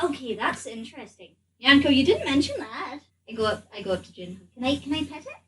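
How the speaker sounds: ordinary delivery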